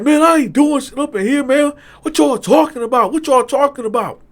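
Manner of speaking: high, loud defensive protest; voicing Moses shouting his innocence; loud denial. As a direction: deny it loudly, indignant. Moses denying everything.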